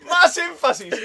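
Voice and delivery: high-pitched playful demand; mock coaching. Listener heckling the dolphin teacher, mock-urgent.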